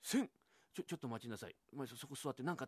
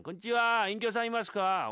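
calling out; 8 semitones higher, 7 dB louder than usual. Acted. Husband calls at the door.

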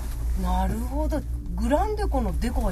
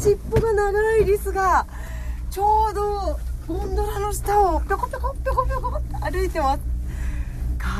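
delighted squeal; 9 semitones higher than usual. Real, not acted. Squirrel spotted from gondola.

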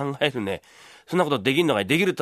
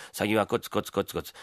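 normal talking voice

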